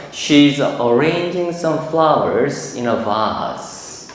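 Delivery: very slow model sentence, "vase" as a long "vahs"; British pronunciation demo. Shown as a demonstration. Teacher demonstrates for exam students, British "vase" as "vahs" in a slow sentence. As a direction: read it out slowly, ending on British "vase" with an open "ah". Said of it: "제가 발음해볼게요".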